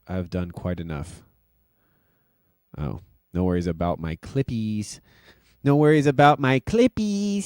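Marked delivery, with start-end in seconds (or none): 5.6-7.4 higher, goofy quote; silly quote voice. Retold or imitated. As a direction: toss it off in a goofy voice. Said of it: "he says".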